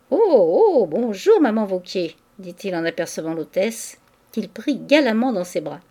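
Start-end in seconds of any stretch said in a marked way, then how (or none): 0.1-2.1 effusive greeting. A narrator's reading. Lodger hailing his landlady, effusive greeting. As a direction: greet her with booming gallantry.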